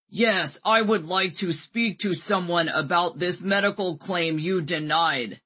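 booming put-on man's voice, slow at the end; deep masculine voice. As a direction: boom it like a man on the phone. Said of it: "put on my deepest, most masculine voice and boomed".

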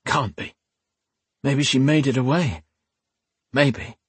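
raw, hoarse and worn out. Acted. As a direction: rasp it like a shredded throat. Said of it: "voice sounding as if he'd gargled broken glass".

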